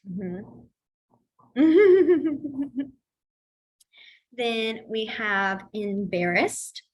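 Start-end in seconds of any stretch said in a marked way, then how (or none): 1.5-2.9 high, loud burst of laughter; rippling laugh that tapers off. Real, not acted. Teacher cracking up during sign class, laughing.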